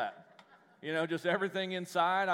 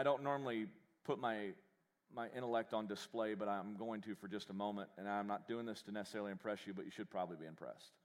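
deadpan mock boast; 6 semitones lower, 7 dB quieter than usual. Mock-humble deadpan aside.

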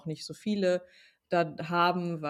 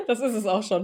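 laughing; 4 semitones higher, 6 dB louder than usual. Real, not acted. Joking goodbye while laughing.

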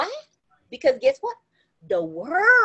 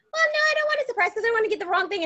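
quick, higher fussy mother voice; a higher, hurried mom brushing it off; mock mother's reply; 7 semitones higher, much faster than usual. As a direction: rattle off mama's excuse fast.

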